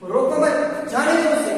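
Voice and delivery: high, loud "रोको मत", brief break, "जाने दो उसे"; pause after मत. Shown as a demonstration. Teacher demonstrating the comma, loud and high with a break after "मत". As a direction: call out "रोको मत", then "जाने दो उसे" after a short stop. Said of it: "वहां हम रुक जाते हैं".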